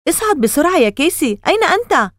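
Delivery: high-pitched anxious call; urgent calling. A narrator's reading. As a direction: call out to a brother out of view. Sister calling brother up from cellar. (نداء ملحّ بنبرة عالية)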